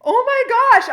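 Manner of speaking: high, loud mock exclamation; acted shock. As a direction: cry out in hammed-up disbelief. Acting out her shocked reply.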